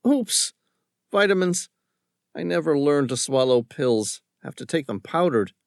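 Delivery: sheepish young lawyer, lightly voiced up; a hint of a chatty young man; Josh's voice.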